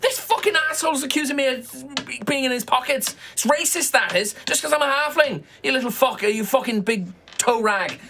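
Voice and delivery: high, indignant halfling voice; halfling pickpocket. Acted. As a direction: protest innocence, high and shrill.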